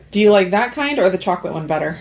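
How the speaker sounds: ordinary delivery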